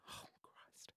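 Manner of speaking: breathless whisper. Real, not acted. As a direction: whisper it, overcome.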